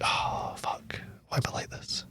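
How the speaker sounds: barely-there whisper to self; hushed, fading self-reproach; near-whispered dismay. Retold or imitated. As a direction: let it sink away into a defeated whisper. Reacting to crying at a film.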